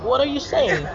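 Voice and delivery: high-pitched echo of confused friends; imitated bafflement. Artist imitating his friends' reply to him, baffled. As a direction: mimic friends throwing the question back.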